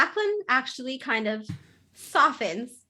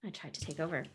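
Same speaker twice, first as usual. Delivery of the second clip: sulky mutter; 6 semitones lower, 13 dB quieter, faster than usual. Acted. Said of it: "Jacqueline is like".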